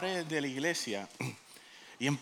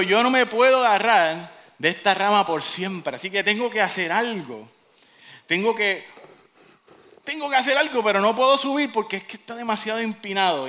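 loud, raised worried reasoning; acted desperation; 4 semitones higher, 6 dB louder than usual. Jack clinging to a branch over the canyon, acted alarm. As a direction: play a man stuck and getting desperate.

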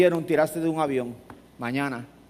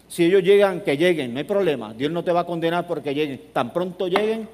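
normal talking voice

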